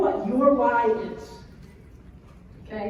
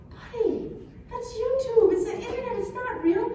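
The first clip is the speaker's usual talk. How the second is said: much higher explaining parent voice; patient mom; 11 semitones higher than usual. Retold or imitated. Mom explaining to her son, much higher. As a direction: gently set him straight. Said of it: "And I go".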